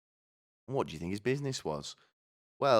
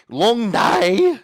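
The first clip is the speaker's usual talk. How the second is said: very high, loud caricature shout; hammed-up retake; 14 dB louder than usual. Pet cremator on his work, high shouted mock voice. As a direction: yell it out cartoonishly.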